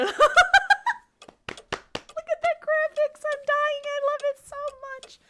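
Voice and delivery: high, loud laughing delight; giddy squeal. Real, not acted. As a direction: gush with laughter. Giddy over game graphics.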